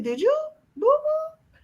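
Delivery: boo-boo squeaked up very high; baby talk on boo-boo; babying mom. Retold or imitated. Mom asking her son in baby talk if he pooped, very high. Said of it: "I said".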